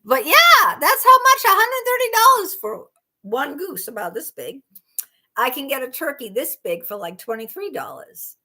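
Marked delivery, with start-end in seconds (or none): 0.1-4.6 high, loud, emphatic disbelief; incredulous exclaiming. Real, not acted. Streamer confirming goose price to viewers, incredulous. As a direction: burst out high and loud, then settle.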